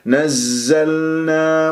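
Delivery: نزلنا slowly, l held before the n; clearly sounded lām. Shown as a demonstration. Teacher models نزلنا, lām clearly sounded. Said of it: "اللام لازم أنا أجيب البينية فيها".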